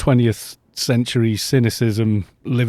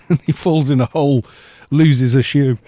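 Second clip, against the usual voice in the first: ordinary delivery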